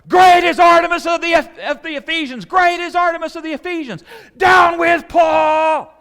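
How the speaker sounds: full-force high-pitched crowd chant; mob shouting. Retold or imitated. Preacher imitating tens of thousands against Paul, all shouting. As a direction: bellow it like thousands chanting together. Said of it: "all shouting".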